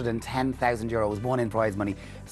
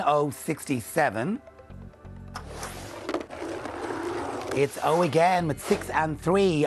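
slow, measured bingo calls; bingo caller; slower than usual. Acted. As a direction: call each number out clearly with pauses.

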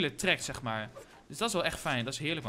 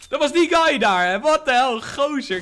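high, loud outburst at a sudden kill; real gamer yell, high and loud; excited shouting; 6 semitones higher, 11 dB louder than usual. Real, not acted. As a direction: shout it in hyped disbelief. Startled reaction to an in-game kill.

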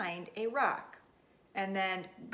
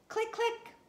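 high, squeaky shutter sound; playful click noise; camera click. Acted. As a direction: mimic the camera going off.

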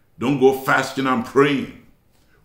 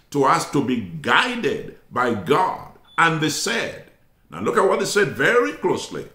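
ordinary talk, nothing marked

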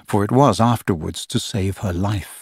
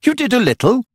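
careless; 14 semitones higher than usual. A narrator's reading. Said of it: "Peter said carelessly".

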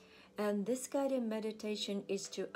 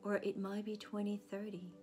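soft, slightly lowered meditation voice; guided meditation; 6 dB quieter than usual. Acted. Counselor leading listeners to picture themselves as a child, soothing meditation voice. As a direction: guide them gently, leaving long pauses between images.

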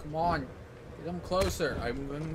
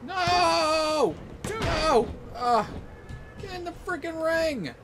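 high, loud desperate yells; frantic shouting; 12 semitones higher, 5 dB louder, slower than usual. Real, not acted. Player screaming at opponent to get in the ring, frantic shout. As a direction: scream at the wrestler to get back.

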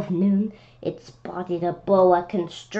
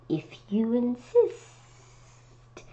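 cold hissing lizard voice; 5 semitones higher, 5 dB quieter, much slower than usual. Acted. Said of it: "said the snake".

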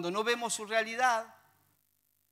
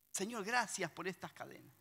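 much softer, hushed thanks to God; quiet mock prayer; 10 dB quieter than usual. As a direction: murmur the prayer as if in a cell. Oración imitada de Pablo. (oración imitada en voz baja)

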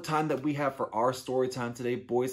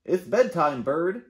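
beaver voice; 7 semitones higher, 8 dB louder than usual. Acted. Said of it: "said Beaver".

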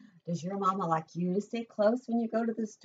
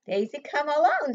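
Mama Duck calling; 8 semitones higher, 7 dB louder than usual. Acted. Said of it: "she said".

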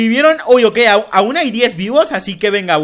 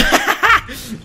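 short burst of giggling; playful laugh. Real, not acted. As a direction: break off into laughter. Laughs while daring players to attack. (risa juguetona)

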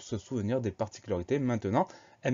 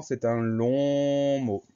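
"long" stretched right out, louder held vowel; drawn-out LONG; 3 semitones higher, 4 dB louder, much slower than usual. Shown as a demonstration. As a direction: stretch "long" to sound as long as the word. Drawls "long" to illustrate length.

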